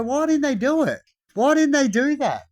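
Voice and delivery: high, incredulous complaint; exasperated questioning. Acted. People asking why the makers did it, exasperated. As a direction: demand an answer, pitch jumping up.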